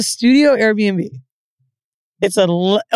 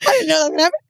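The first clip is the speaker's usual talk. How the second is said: higher, mildly tearful imitation; mock crying; 5 semitones higher, much faster than usual. Girl excuses her breakdown, lightly mock crying. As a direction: repeat her sorry wail, toned down. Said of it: "they're in the corner crying".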